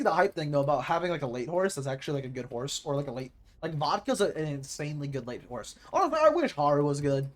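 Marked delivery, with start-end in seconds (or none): none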